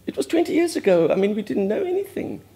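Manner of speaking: anguished outburst. Real, not acted. Bursting out in remorse.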